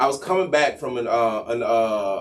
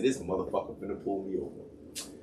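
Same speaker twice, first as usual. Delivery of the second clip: low, soft grumble; quietly irritated aside; annoyed mutter; 6 semitones lower, 11 dB quieter than usual. Retold or imitated. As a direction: mutter it to yourself, resigned. Muttering to himself before being stopped.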